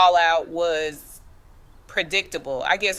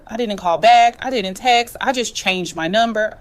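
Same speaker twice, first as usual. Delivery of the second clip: firm and loud; 4 semitones higher, 7 dB louder than usual. Real, not acted. Defiant cutoff of family.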